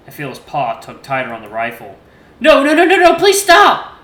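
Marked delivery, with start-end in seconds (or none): 2.4-3.9 very high, loud terrified screams; panicked screaming. A narrator's reading. Son screams at Dad to put the rifle down, panicked. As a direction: scream no over and over.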